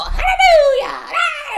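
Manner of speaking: high, loud churchy holler, drawn out; granny's hallelujah. Retold or imitated. Granny shouting in church, a long loud high holler. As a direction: belt it like granny catching the Holy Ghost. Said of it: "granny go".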